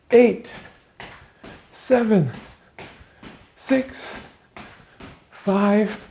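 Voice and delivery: loud, high coach countdown; shouted countdown. Real, not acted. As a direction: push them through the last reps.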